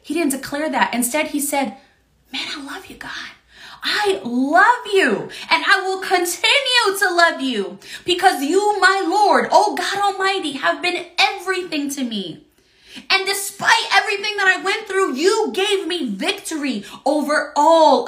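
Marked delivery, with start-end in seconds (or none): 2.3-3.3 breathy whispered words to God; whispered prayer. Acted. Preacher voices his own prayer. 3.8-15.5 fervent prayer. Acted. Preacher voices fervent prayer.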